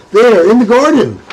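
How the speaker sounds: loud high call from afar. Acted. A man calls to the others, loud shout. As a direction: shout to the others where she is. Man spotting her, calling out.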